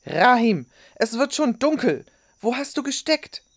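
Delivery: loud, high angry scolding; erbost. A narrator's reading. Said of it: "funkelte ihren Enkel erbost an". (erbost schimpfend, laut)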